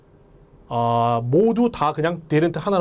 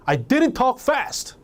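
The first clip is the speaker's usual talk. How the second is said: acted indignation, pitched high and drawn out; angry denial; 9 semitones higher, much slower than usual. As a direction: protest slowly against the accusation. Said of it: "화가 나서 그러는 거예요". (화난 듯 높은 톤으로 항변)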